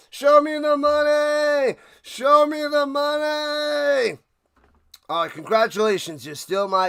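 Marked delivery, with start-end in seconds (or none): 0.1-4.2 loud, high, slow bellow of "Show me the money"; all-out shout answering the call for more; louder. Acted. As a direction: yell it even harder on command.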